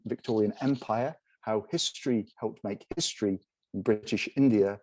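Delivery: ordinary delivery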